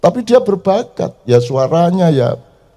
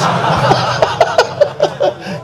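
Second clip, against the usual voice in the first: high, breathless wheeze of a laugh; wheezing laugh; 13 semitones higher, 4 dB quieter than usual. Real, not acted. Speaker cracks up at his own joke with a high, breathless wheeze. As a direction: burst into a silent, airy laugh.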